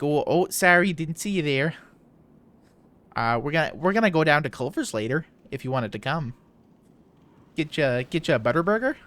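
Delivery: put-on big Minnesota-Wisconsin accent. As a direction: put on a thick, playful Midwestern voice. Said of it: "the very stereotypical Midwesterner accents".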